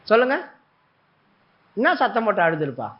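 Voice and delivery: ordinary talk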